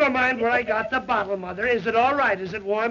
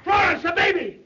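panicked shout; 8 semitones higher than usual. Acted. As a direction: yell it out in alarm. Panic, baby missing.

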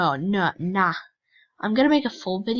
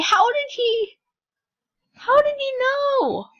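much higher, louder disbelieving squeak; shocked squeal; 7 dB louder than usual. Real, not acted. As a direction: pitch it way up in shock. Shocked at being found.

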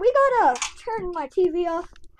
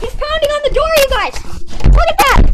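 high, loud frantic yell; panicked yelling; 8 semitones higher, 12 dB louder, faster than usual. Acted. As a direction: scream it out in terror. Pounding on the door, panic.

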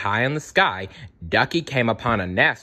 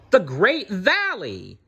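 high, drawn-out exclamation; excited cry; 9 semitones higher, slower than usual. A narrator's reading. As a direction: cry out in wonder, stretching it. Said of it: "Ducky cried".

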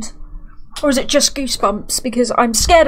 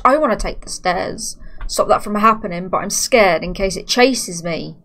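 ordinary talk, nothing marked

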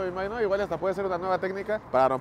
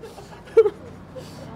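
high breathy laugh. Real, not acted. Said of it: "Voy a llorar de".

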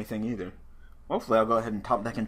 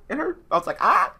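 retold pained cry; 6 dB louder than usual. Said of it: "when I tried to win, it hurt".

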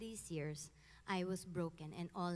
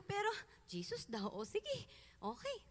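bewildered; 12 semitones higher than usual. Retold or imitated. Her bewildered retold reaction.

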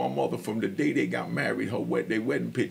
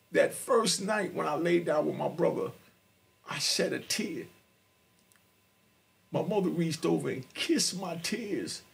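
ordinary delivery

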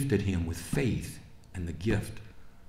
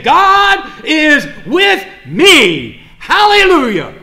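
God is with me, very slow, loud and high; preacher shouting testimony at the climax; slow proclaimed shout; 17 dB louder, much slower than usual. Acted. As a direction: draw it out then cry hallelujah. Climax of sermon.

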